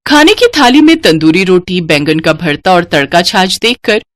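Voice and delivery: ordinary delivery, nothing marked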